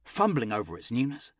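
ordinary delivery, nothing marked

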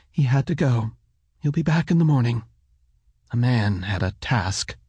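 lightly voiced mother, slightly firm; understated firmness from a woman reassuring a child; mother's hardened voice. A narrator's reading. Mother explains father's absence to son, faintly hardened voice. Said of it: "the way her voice hardened".

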